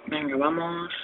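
very soft encouraging mutter; cursing under breath. Real, not acted. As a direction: urge yourself on under your breath. Focusing on hard level.

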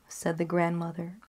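plain reading voice

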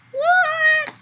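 high, loud amazed yelp; delighted surprise. Real, not acted. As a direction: gasp it out in happy disbelief. Surprised by ball animation.

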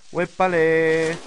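loud, long stretched whoop; drawn-out exclamation. Real, not acted. Player after a scare in a game, drawn-out exclamation.

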